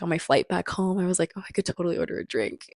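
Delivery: usual speaking voice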